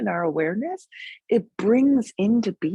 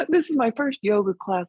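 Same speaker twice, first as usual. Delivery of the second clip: voiced aloud, higher-pitched mock protest; student clarifying; 5 semitones higher than usual. Student justifying the claim to teacher, lightly protesting. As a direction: imitate a student explaining what they really meant.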